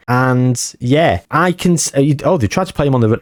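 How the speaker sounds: ordinary talk, nothing marked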